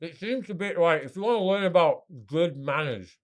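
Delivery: chewing while talking; mouth full. Real, not acted. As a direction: talk through a mouthful. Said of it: "now I have to talk with my mouth full".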